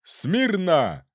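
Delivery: long high parade-ground bark; booming command. A narrator's reading. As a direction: bellow it like a colonel. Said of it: "трубным голосом подал команду".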